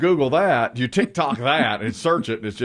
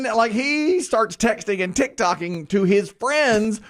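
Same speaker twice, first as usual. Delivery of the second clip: ordinary talk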